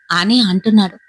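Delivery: plain reading voice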